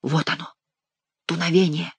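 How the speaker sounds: soft, quiet announcement; quiet, under the breath; hushed. A narrator's reading. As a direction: say it very softly. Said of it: "ещё тихо ответил король".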